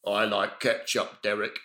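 low, slow, soft little lady voice; Maureen voice. Acted. Maureen answering Derek about chips, slow, low and soft. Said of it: "So I could almost be like".

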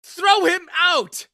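very high, very loud yell for security; shriek. A narrator's reading. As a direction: shriek the order, stretching the words. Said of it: "he shrieked".

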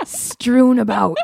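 put-on slow, drawn-out, prim child's wording; very proper schoolboy. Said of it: "this proper description".

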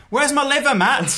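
high, loud mock panic about a missing liver; mock panicked victim. Joking with friends about being chopped up, hammed-up high panic. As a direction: squeal it out like a hammed-up horror victim.

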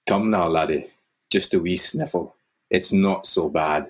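a light Scottish lilt as Roger; gentle Scottish father soothing a child; a hint of Scottish accent. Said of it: "I hate this Scottish accent".

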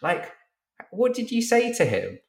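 high, disbelieving, laughing question; high, astonished mock question; amazed asking. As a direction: ask in sheer disbelief. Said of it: "I was like".

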